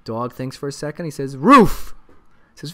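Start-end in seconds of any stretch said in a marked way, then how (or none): 1.4-1.9 a loud single dog bark, word as woof; dog barks the answer. Acted. Dog answers talent agent with a loud bark.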